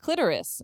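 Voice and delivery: clitoris as "KLIT-er-is"; stress on first syllable. Retold or imitated. Friends quoting the other pronunciation, clitoris as "KLIT-er-is". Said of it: "another way to pronounce".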